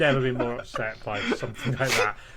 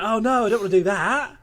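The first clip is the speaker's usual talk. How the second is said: mock panic; 8 semitones higher, 7 dB louder than usual. Refusing to tell Charlotte.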